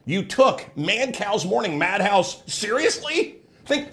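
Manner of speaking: ordinary delivery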